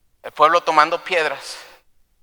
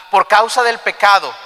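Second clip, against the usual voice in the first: raised, emphatic preacher's voice; impassioned preaching; 8 semitones higher, 5 dB louder than usual. Acted. Preacher warning that sin cost soldiers' lives, emphatic. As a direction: drive the consequence home to the congregation.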